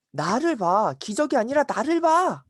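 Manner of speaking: rising to a high, insistent command; emphatic rebuke. Acted. Jesus rebukes miracle seekers, emphatic and rising. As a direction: demand their attention.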